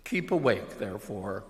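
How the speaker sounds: normal talking voice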